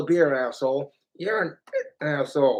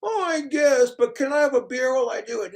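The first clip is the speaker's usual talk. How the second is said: high reluctant cartoon husband, quickening; Homer Simpson; 7 semitones higher, faster than usual. Homer bargains with wife for beer, high whiny plea. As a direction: grumble into a hurried plea. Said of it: "My Simpsons voices".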